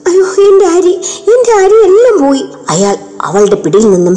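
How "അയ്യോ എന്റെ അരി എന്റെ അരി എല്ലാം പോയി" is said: put-on high, louder child's wail; a little girl crying over her lost rice; distressed child voice, much higher. A narrator's reading. Girl wails over spilled rice.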